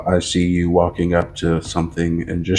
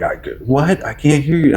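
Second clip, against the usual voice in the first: higher-pitched mock yell; exasperated retold call, raised in pitch; 6 semitones higher than usual. Dad re-enacts answering his kids, mock yelling. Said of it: "I'm yelling at them".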